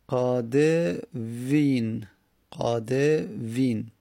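"قادوین" very slow, qa-de-viin with long vowels; drawn-out demonstration. Shown as a demonstration. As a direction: stretch each syllable of "قادوین". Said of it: "نمیشه تلفظ داد که".

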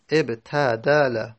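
ابتدل drawn out slowly, syllable by syllable; slow teaching demo. Shown as a demonstration. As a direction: spell out ابتدل slowly for students. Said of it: "همون ابتدل".

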